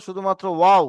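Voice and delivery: ordinary delivery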